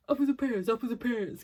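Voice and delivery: mock London accent, punched out loud; dad's London accent. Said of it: "Whenever I do my dad's accent".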